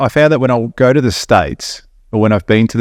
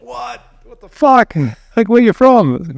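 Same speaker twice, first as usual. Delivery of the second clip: high-pitched imitation of amazed strangers; startled amazement; 11 semitones higher, slower than usual. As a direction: mimic someone astonished by a foreign accent. Imitates Americans startled by accent.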